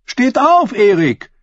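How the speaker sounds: high, loud female voice daring him; woman's challenge. A narrator's reading. Woman on the steps challenges old Erik, loud and high. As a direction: call on him to stand. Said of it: "rief sie".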